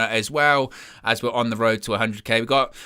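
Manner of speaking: ordinary talk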